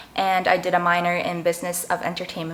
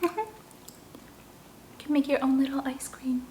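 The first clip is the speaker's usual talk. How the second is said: laughing whisper; 6 semitones higher, 9 dB quieter, slower than usual. Real, not acted. Giggly aside about the dessert.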